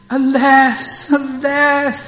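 high wailing lament, slowed on ruined. Acted. Mukara wails to Nandimukhi over ruin. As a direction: cry out alas as if all is lost.

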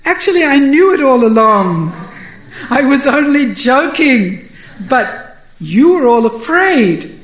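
loud, laughing cover-up of fright; relieved bluster. A narrator's reading. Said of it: "sighs a sigh of relief and he says".

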